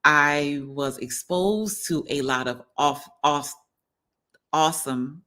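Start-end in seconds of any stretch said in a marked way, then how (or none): none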